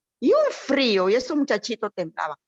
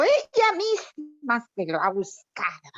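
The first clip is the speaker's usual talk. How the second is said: scornful judging; 3 semitones higher, slower than usual. Retold or imitated. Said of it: "Pero así, ¿eh?".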